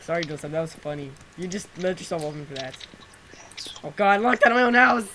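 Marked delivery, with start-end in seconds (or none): 3.8-5.1 high, loud dismay; dismayed shout. Real, not acted. Player locked out of own house, dismayed shout. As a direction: wail it loudly in disbelief.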